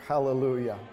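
usual speaking voice

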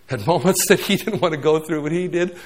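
ordinary talk

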